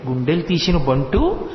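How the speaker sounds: ordinary talk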